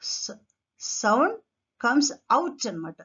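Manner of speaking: usual speaking voice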